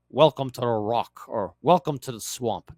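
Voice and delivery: put-on a faint try at a Scottish movie-star accent, barely there; Sean Connery attempt. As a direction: play it like a trailer tagline, with only a hint of Scottish. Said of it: "I can't do a Sean Connery accent".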